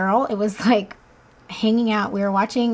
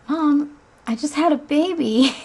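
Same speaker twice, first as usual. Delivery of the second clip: high, louder mock-incredulous retort; incredulous reply; 6 semitones higher, 3 dB louder than usual. New mom answering her mother, playfully incredulous. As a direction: reenact the duh-answer to Mom, pitched up. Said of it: "That's what I said".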